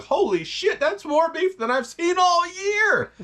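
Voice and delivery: high, slow gasp of disbelief at so much beef; amazed outsider. Retold or imitated. Someone from a poor country, stunned by the ribeye pile: high, slow amazement. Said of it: "then you're like".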